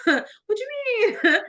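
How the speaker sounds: laughing disbelief. Retold or imitated. Retelling her own shocked reply.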